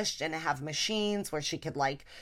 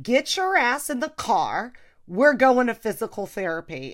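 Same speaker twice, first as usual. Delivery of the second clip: louder, firm order, pitched up; stern daughter ordering mom to therapy; bossy shout; 4 semitones higher, 6 dB louder than usual. Retold or imitated. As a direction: lay down the law like a parent. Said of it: "So now I was like".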